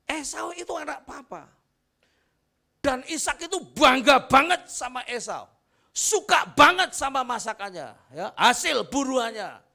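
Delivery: loud, high shouted sermon climb; full-force preaching. Acted. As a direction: preach it to the back row. (khotbah berapi-api dengan suara lantang)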